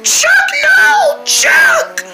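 very high mock lament, half laughing; hammed-up wail. Kids mourning Chuck's kidnapping, hammed-up wail. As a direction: wail dramatically like a play tragedy.

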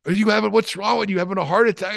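high, fast worried question; imitating a startled partner; mock alarm at a choking teammate. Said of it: "Knobs goes".

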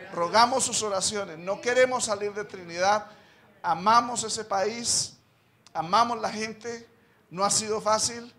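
loud, impassioned pulpit plea; fervent preaching. Real, not acted. Pastor pleads with the congregation, loud and fervent. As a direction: preach it out, asking the church to pray.

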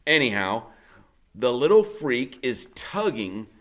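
ordinary delivery

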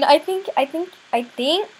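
usual speaking voice

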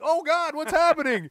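sudden high, loud panicked yelp; panicked cry. Retold or imitated. Retelling a song crash, a mock panicked shout. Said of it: "You're like".

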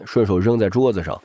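usual speaking voice

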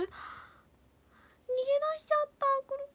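高く、とてもゆっくり甘える子供の声; 先生から逃げた園児になりきって; 幼い園児の声. Pretend. 迷子の園児を演じる. (迷子の園児が甘えるように、高くゆっくり)

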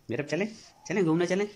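high cheerful coaxing. Real, not acted. Father invites his baby daughter for a walk, high cheerful baby talk.

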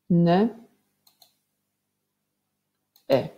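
Zi, e, l, o, n, y as isolated sounds. Shown as a demonstration. Teacher counts out the sounds for pupils, each sound separate. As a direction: say each sound of zielony alone, with long pauses. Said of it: "Dzielimy na głoski teraz".

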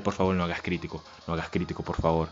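anxious muttered prayer to the game; hushed pleading. Real, not acted. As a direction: beg under the breath, hoping. Begging the enemy not to land a crit.

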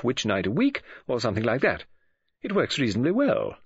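Mrs Oliver, explaining. Acted. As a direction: explain eagerly, with swooping emphasis.

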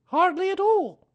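sing-song motherly greeting; Kanga voice. A narrator's reading. Kanga greets Pooh, very high and motherly.

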